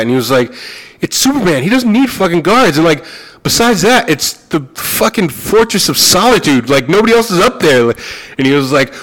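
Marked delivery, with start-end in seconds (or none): 1.0-7.9 higher, incredulous retelling of an exasperated writer; mock disbelief, pitched up; exasperated retort. Writer scoffing at the producer, in exasperated disbelief. Kevin Smith exasperated at Peters.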